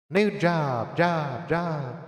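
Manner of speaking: loud, high mock echo; vocal echo effect on "job". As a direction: repeat "job" like a booming echo. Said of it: "it began to echo".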